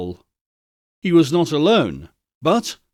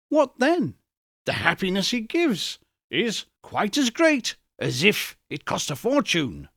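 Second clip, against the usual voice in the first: higher, eager and heated defense; 5 semitones higher than usual. Acted. Scrooge heatedly defending Fezziwig to the spirit. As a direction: pour it out like his younger self. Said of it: "heated by the remark, and speaking unconsciously like his former".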